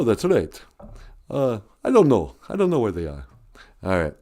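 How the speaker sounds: normal talking voice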